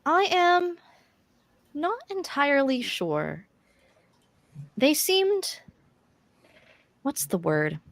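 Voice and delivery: a high unsure voice groping for the right word; [name]'s voice. Acted. The seer answering the party, high and unsure.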